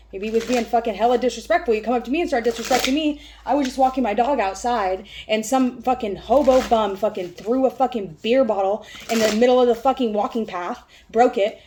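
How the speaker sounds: loud, fast, heated ranting; worked-up rant. Real, not acted. Ranting to viewers about a guy breaking a bottle, loud and heated.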